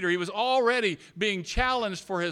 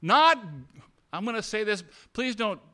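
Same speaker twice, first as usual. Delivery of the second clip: louder, higher, rousing the room; full-force preaching; 4 semitones higher, 5 dB louder than usual. Acted. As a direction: build it like a pulpit climax.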